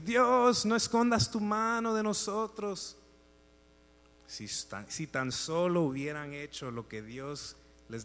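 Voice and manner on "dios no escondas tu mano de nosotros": tearful plea. Retold or imitated. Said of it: "empieza a llorar y decir".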